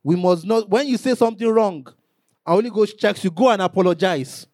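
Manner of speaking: ordinary talk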